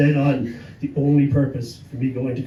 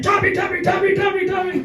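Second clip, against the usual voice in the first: much higher, louder chant of his name; crowd shouting; 5 dB louder than usual. Retold or imitated. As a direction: yell it like an adoring fan crowd.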